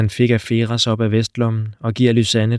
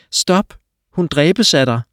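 desperate shout; 6 semitones higher, 4 dB louder, slower than usual. Acted. Lysanne shouts to stop Tro.

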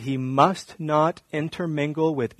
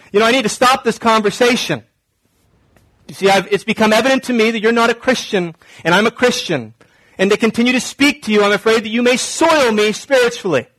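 high, loud, quick self-righteous brush-off; mock sanctimonious rejection; 7 semitones higher, 6 dB louder, faster than usual. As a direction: cut them off in mock holier-than-thou alarm. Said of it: "Is that how you talk?".

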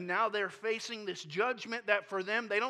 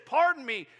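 "Pardon me" much louder and higher in a whiny protest; whining Gideon; 6 semitones higher, 11 dB louder than usual. Retold or imitated. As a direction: push "Pardon me" up into an indignant whine. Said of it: "he goes into just whining".

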